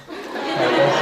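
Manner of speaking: breathy burst of real laughter; amused laugh. Real, not acted. Laughing to the audience at his own snake mistake. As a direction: laugh at yourself, then show it.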